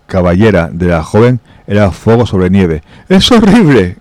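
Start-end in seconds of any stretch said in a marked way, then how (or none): 3.1-4.0 high-pitched cry of horror, acted by a narrator; shrieked line at storytelling volume; cried out. A narrator's reading. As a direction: exclaim in horror, pitch leaping up. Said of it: "gritó Marian".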